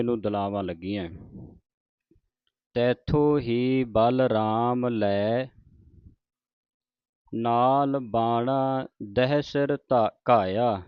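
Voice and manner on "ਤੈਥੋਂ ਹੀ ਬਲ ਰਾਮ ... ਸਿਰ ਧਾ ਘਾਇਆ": drawn-out devotional reading, line by line; slow scripture recitation. Shown as a demonstration. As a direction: recite it slowly like Gurbani santhiya. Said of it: "ਦਹਿ ਸਿਰ ਇਕੱਠਾ ਪਾਠ ਹੈ". (ਹੌਲੀ ਹੌਲੀ ਪਾਠ ਦਾ ਉਚਾਰਨ)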